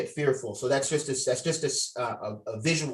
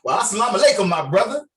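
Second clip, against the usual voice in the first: loud, higher sarcastic greeting; mocking greeting; 6 semitones higher, 8 dB louder, faster than usual. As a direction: throw the blessing back at him with scorn. Officer mocks parolee's new religion.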